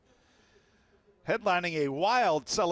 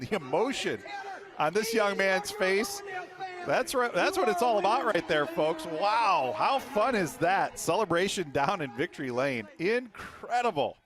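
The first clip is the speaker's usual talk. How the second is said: thrilled commentator; 5 semitones higher than usual. Real, not acted. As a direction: savour the moment, booming with joy. Commentator thrilled by emotional win.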